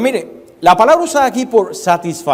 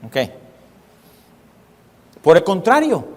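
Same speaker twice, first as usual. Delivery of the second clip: normal talking voice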